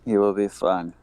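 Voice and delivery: ordinary delivery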